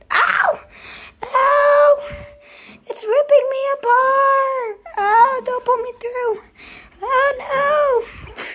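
high, panicked yelps of pain; pained high cries. Pretend. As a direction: cry out as if torn apart. Game character being ripped apart.

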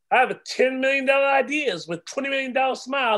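proud shout. Acted. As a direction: crow it out to the room. Boasting poem builds to a shout.

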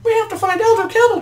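loud, squeaky falsetto; urgent high-pitched cartoon cry; Cuphead. Frightened brother plans their escape, squeaky and loud.

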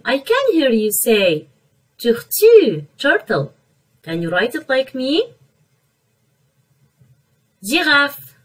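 ordinary talk, nothing marked

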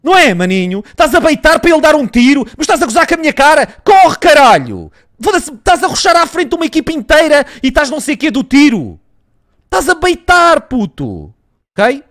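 high, loud furious tirade; angry rant. Real, not acted. Gamer raging at his teammate over a bad bait, loud high furious rant. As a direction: tear into him for the bad play.

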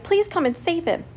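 high-pitched little plea; urgent baby mouse voice; crying baby mouse. A narrator's reading. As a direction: beg for help in a small high voice. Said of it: "he cried".

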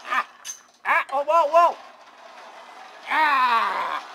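high, loud startled yell of delight; screaming through the sudden drop; thrilled scream. Real, not acted. Third go on the ride.

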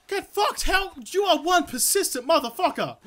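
high-pitched, incredulous cursing; exasperated outburst. Real, not acted. Player cursing the crocodile that keeps chasing him, exasperated. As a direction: curse your relentless pursuer in real exasperation.

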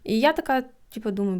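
ordinary delivery

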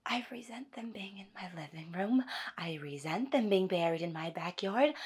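soft, contained grievance; hushed, aggrieved confiding; quiet resentment. Acted. Wife confides her resentment.

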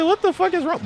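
high-pitched retold disbelief; incredulous reply. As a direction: act out a baffled interruption. Retold shocked reply to her.

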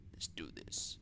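hushed gamer whisper; low secret whisper. Real, not acted. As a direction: breathe it out, barely voiced. Gamer psyching up quietly.

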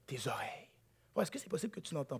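whispered reveal. Real, not acted. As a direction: drop to a whisper on the key word. (chuchoté)

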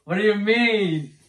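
high, loud and drawn out; loud confusion. Real, not acted. Told the guess is wrong, loud bewildered question. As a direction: protest loudly over the music. Confused, deafened by headphone music.

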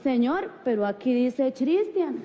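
slow "Cristian" said with a CH as in church; drawn-out, puzzled "Cristian" starting like "chee"; spelling pronunciation. Retold or imitated. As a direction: read "Cristian" literally as "Chris-tian" with a ch sound. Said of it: "pero se dice Cristian".

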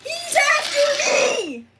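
high, drawn-out holler; panicked shout. Acted. Chased engine interrupts calm chat, high panicked shout.

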